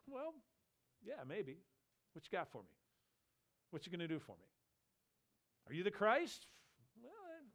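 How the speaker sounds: mock hesitant, toying with the offer; lightly acted wavering under temptation; tempted hedging. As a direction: hedge coyly between pauses, as if open to a deal. Acts out John being tempted.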